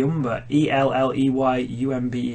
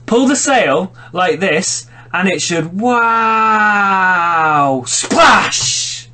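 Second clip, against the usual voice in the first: excited shout; 5 semitones higher, 3 dB louder, much slower than usual. Acted. Acting out a character's line.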